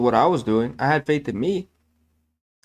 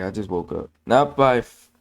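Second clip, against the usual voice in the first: ordinary delivery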